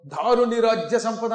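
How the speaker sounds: loud, ringing stage-verse delivery; booming heroic recitation; forceful dramatic declamation. Shown as a demonstration. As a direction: thunder it out like a mythological actor. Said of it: "అవసరాన్ని బట్టి గట్టిగా అరవాలి".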